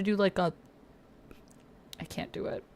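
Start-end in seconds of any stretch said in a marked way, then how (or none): 2.0-2.6 breathy whisper to herself. Real, not acted. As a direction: whisper it, giving up. Gives up on a sound.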